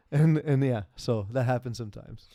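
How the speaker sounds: normal talking voice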